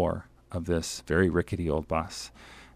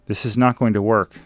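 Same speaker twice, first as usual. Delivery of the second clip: slightly raised, mildly firm read-aloud remark; said out loud; 4 semitones higher, 8 dB louder than usual. A narrator's reading. Traveler to fellow pushers stuck in mud, said out loud. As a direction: say it out loud, with mild emphasis. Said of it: "I said out loud".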